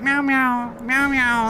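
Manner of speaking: high playful mock meowing; cat meows. Streamer mimics his cat at the pound, high playful meows. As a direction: meow like a chipper cat. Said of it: "She's, like".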